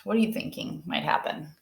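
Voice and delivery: ordinary delivery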